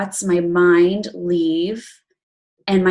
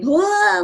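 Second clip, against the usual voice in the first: mock whoa; 12 semitones higher than usual. Retelling her sobbing release.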